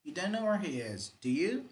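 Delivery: "do you" swept up high as a real question; rising intonation on tag. Shown as a demonstration. Teacher repeats the real-question example, rising intonation on "do you". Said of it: "You rise".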